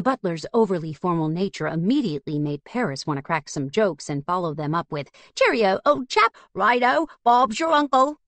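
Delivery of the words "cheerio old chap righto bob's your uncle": high, hammy upper-class British; mock posh British accent. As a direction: play up a silly posh Brit. Paris imagines joking posh British.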